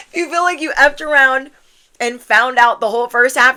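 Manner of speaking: high, loud, amused declaration; loud emphatic repeat. Real, not acted. Reader owns up to a messed-up first half, loud and emphatic. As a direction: belt it out after a laugh. Repeats it louder after laughing.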